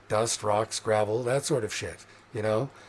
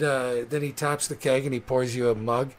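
ordinary talk

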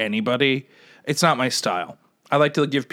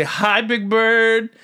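high, drawn-out singsong hello; cheery greeting; 8 semitones higher, much slower than usual. Retold or imitated. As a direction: call out a sweet, slow hello. Repeats his greeting to Big Bird.

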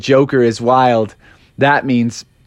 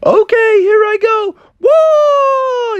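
squeaky frog voice; 4 dB louder, much slower than usual. Acted. Froggy jumps off, exclaiming.

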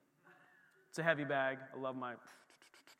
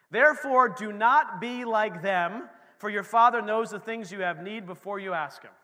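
scripture reading; 7 dB louder than usual. Acted. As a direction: read it out as holy word.

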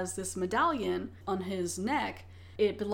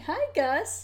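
high, sing-song hello to the cat; pet greeting; 9 semitones higher than usual. Real, not acted. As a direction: chirp a higher hello at the cat.

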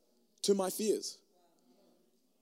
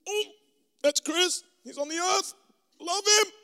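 much higher, breezy and offhand; casual doting voice; 10 semitones higher than usual. Pretend. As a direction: toss it off like a doting parent.

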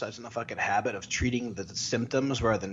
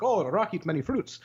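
higher, imitated Indian accent; 7 semitones higher than usual. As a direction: mimic an Indian commenter's accent. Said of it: "like, fucking India".

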